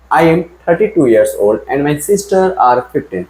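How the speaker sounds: reading the sentence out slowly and clearly; teacher reading a test sentence slowly; slow dictation reading. Shown as a demonstration. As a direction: dictate "I am thirty-two years old and my sister are fifteen" at half speed. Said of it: "answer बताओ".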